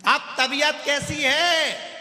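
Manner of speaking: slow, high, booming question; shouting. Acted. Deaf visitor asking after the patient, shouting. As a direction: yell it slowly as if the listener is deaf. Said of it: "चिल्ला के बोल रही है".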